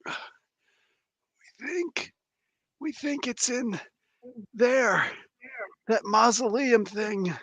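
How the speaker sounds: weak, high, halting voice; a wounded prisoner straining to get words out; dying captive. Acted. Game master as bleeding captive answering questions, weak and high.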